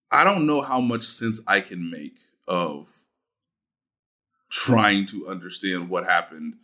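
ordinary talk, nothing marked

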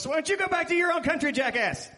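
fast, high, loud mock outburst; retold angry comeback. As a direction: fire it back in a rushed, hammed-up yell. Acts out his angry comeback.

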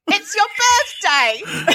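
high, sing-song birthday greeting; teasing the prank call; mock birthday caller. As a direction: chirp it up high like a party call. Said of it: "called somebody else and said".

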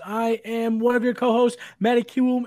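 ordinary delivery

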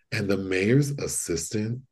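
ordinary talk

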